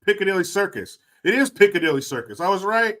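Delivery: ordinary delivery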